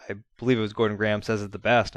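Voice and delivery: ordinary delivery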